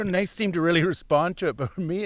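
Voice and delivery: ordinary delivery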